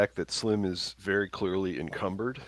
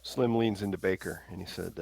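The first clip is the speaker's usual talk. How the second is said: ordinary talk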